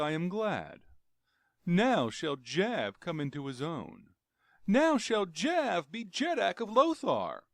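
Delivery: high, loud, gloating proclamation; gloating Jav. A narrator's reading. Jav gloating to himself over his sleeping master, high and loud. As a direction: crow it like a scheming usurper.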